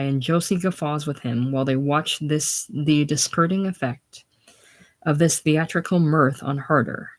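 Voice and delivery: ordinary delivery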